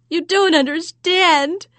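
high, loud and slow, pleading; loud desperate plea. Acted. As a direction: beg her to understand. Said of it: "explained the banker".

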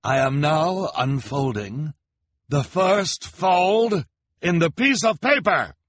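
milk the moment for the crowd; grand announcement. A narrator's reading. Olaf dramatic announcement.

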